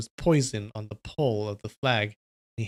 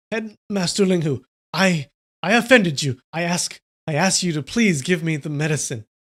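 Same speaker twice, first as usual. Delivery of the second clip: louder, higher, stammering appeal; worried plea, tripping over the words; desperate pleading; 4 semitones higher, 6 dB louder than usual. A narrator's reading. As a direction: beg haltingly for help. Frightened man begs for the medicine.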